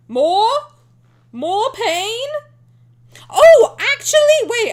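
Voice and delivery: loud high disbelief; shocked. Real, not acted. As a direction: squeal out in shock. Outraged at more myths.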